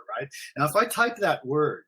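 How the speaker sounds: ordinary talk, nothing marked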